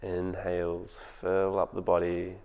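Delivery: calm, unhurried meditation voice with long pauses; guided meditation. Acted. Teacher guiding home listeners through their breathing, in a calm meditation voice. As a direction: ease them into the breath, gently.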